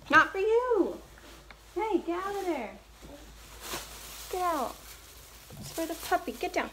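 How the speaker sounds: scolding the dog. Real, not acted.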